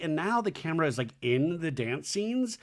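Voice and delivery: ordinary talk, nothing marked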